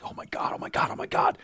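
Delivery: breathless whispered oh my God; starstruck whisper. Retold or imitated. [name] retelling meeting his idol, awed whisper.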